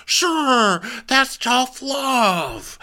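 mocking Shep brushing off criticism, high and slow; smug Shep. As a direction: shrug it off smugly. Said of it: "And he goes".

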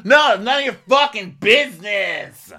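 high, full shout of mock fury; explode, punching each word loudly; imitated raging yell. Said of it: "already fucking raging".

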